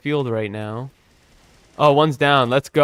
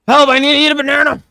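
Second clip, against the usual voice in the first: high, fast, loud cartoon panic; silly panicked voice; 13 semitones higher, 7 dB louder, much faster than usual. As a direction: yelp it like a frantic cartoon. Silly put-on gameplay voice.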